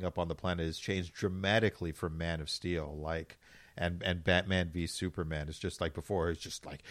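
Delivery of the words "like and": ordinary talk, nothing marked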